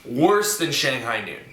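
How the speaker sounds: ordinary talk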